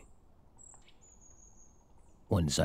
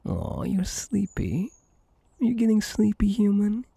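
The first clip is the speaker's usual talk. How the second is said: put-on much higher teasing baby talk; cooing; 11 semitones higher, 3 dB louder than usual. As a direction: coo at a drowsy little one. Cooing at sleepy human.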